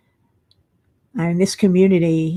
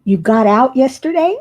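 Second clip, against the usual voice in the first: surprised; 4 semitones higher, 6 dB louder than usual. Real, not acted. Surprised at a viewer's news.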